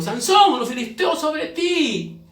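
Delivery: high, drawn-out alarm cry; sudden high, loud dramatic call; calling out a warning. Acted. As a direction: cry out to wake a sleeper. Acted warning cry waking Samson.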